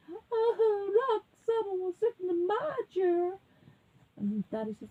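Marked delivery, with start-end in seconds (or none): 0.1-3.4 very high, tearful cub wail; crying little bear. A narrator's reading. Little bear finding chair used, wailing tearfully. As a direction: whine it high in mock tears. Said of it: "And the little bear says".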